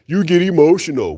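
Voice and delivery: high, put-on mocking voice; mocking commenter. Mimicking a commenter who told him he gets emotional, in a high sneering mock voice. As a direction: parrot the commenter back with a sneer.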